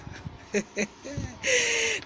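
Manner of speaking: high-pitched giggling laugh; amused laugh. Real, not acted. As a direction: laugh at your own fancy word. Laughs at own fancy word.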